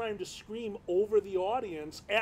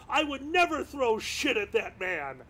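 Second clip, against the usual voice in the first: normal talking voice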